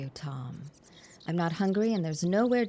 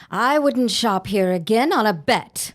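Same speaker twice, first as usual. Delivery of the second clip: 大声で捨て台詞; 怒って声を張り上げる; loud parting shot; 8 dB louder than usual. Acted. Helen storms out insulted.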